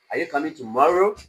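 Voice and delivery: "Are you coming tomorrow" lifting at 'tomorrow'; rising tone. Shown as a demonstration. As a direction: raise the voice towards the end, on 'tomorrow'. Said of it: "You are raising your voice".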